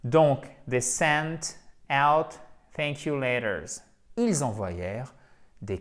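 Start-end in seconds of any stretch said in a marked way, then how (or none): 0.7-3.8 "they sent out thank you letters" read slowly, each word clear; slow model reading. Shown as a demonstration. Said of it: "model sentence read slowly". (lecture lente et articulée de la phrase modèle)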